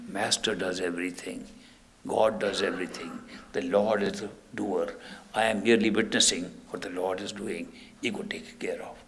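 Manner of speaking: soft, hushed surrender, fading to a whisper; devout murmur. Shown as a demonstration. Disciple letting go of ego, hushed devout murmur. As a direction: hand it all over to the Lord, quietly. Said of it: "by saying".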